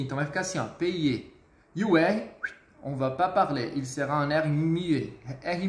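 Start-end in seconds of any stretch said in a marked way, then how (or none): 0.7-1.3 payer with the ay sounded like e-i and a silent final r. Shown as a demonstration. Teacher showing the class payer with a silent r. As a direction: say payer as pe-ie, no r at the end. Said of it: "som de E I".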